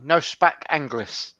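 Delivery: slow, broken English in a fake foreign accent; mock foreign accent. Gamer fobs off a stranger's chat, fake foreign accent. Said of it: "I was in one of those moods".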